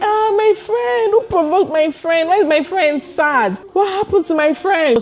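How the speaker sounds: high-pitched doting friend act; mock-concerned friend. Friend fussing over the upset narrator.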